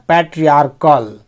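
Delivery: ordinary talk, nothing marked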